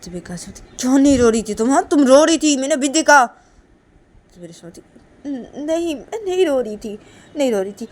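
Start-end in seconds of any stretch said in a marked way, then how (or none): 0.8-3.2 loud, high-pitched scolding; heated acted confrontation; angry accusation. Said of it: "तुम गुस्सा मत करो ना". 5.3-7.9 defensive denial. Acted. Accused character stammers a denial.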